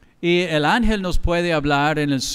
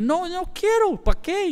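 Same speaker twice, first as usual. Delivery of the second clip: high refusal. Retold or imitated. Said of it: "Y le dije".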